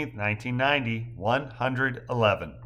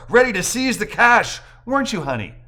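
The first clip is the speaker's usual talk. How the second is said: usual speaking voice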